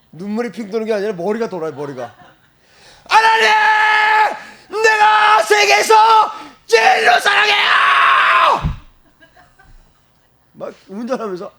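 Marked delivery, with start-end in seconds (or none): 3.1-8.7 very high, very loud and slow cry; full shout to God. Retold or imitated. Preacher demonstrating to the congregation, shouting her love. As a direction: shout it out with everything. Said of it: "소리 막 지르면서".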